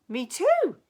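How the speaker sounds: high, loud burst of agreement; excited exclaim. Acted. Knight eagerly agreeing with the princess, excited. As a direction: blurt it out eagerly. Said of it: "exclaimed the knight".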